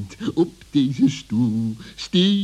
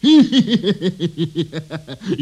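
relieved fox laugh; 3 semitones higher, 4 dB louder than usual. Acted.

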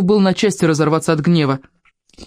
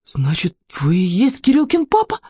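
wondering, rising high at the end; amazed question; 3 semitones higher than usual. A narrator's reading. Petya realizes the man is his friend's father, amazed.

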